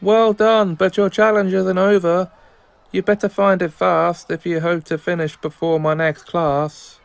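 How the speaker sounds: high-pitched, projected teacher voice; teacher character. Acted. Teacher urging a student before next class, high teacher voice. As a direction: read it as a teacher setting a challenge. Voicing game teacher's challenge.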